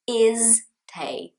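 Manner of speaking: "is te" for stay, vowel before the S, two beats; mistaken two-part stay. Shown as a demonstration. Teacher mimics Brazilians' classic mistake, "is te" in two beats. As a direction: put an "is" on the front, then "te" as tay. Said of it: "classic mistake is that I will hear Brazilians saying".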